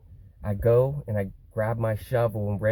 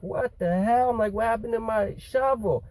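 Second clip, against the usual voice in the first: much higher, acted-out disbelief; incredulous; 11 semitones higher than usual. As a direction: replay the shock of finding it broken. Reacting to finding his shovel broken.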